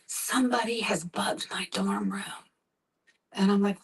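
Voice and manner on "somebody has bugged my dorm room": hushed paranoid whisper. Retold or imitated. Son on the phone at 3am, whispering fearfully. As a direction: whisper it like the room is bugged. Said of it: "And he said".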